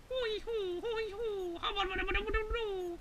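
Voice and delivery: high, singsong hooting nonsense; made-up bird call. Acted. Bear tries talking in bird language.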